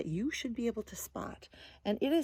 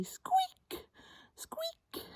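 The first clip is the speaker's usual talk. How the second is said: tiny, very high squeaks; pitched right up, mousy peep; mouse squeaking; 3 dB quieter than usual. Acted. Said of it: "They say".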